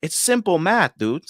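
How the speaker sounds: high, condescending salesman voice, talking down to him; mocking salesman. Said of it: "and he goes".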